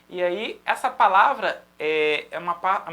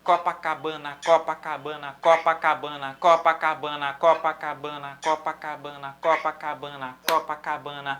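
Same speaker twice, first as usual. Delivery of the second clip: "Copacabana" chanted in five even syllables, low monotone loop; cinque note uguali; 4 semitones lower than usual. Shown as a demonstration. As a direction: fit "Copacabana" evenly into each beat, accent on the first syllable. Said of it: "Vou dar um exemplo".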